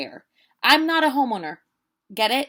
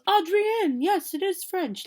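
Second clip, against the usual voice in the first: imitating a Frenchwoman's lilting French accent, pitched much higher; 10 semitones higher, slower than usual. French homeowner answering Adrienne, in a high imitated French accent. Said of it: "like, in her beautiful French accent".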